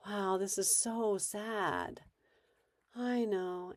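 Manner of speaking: slow, soft, flat-toned calm; brain dead reply. Shown as a demonstration. Parent calmly deflecting an upset kid, slow and soft. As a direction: answer softly, giving nothing back. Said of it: "going brain dead".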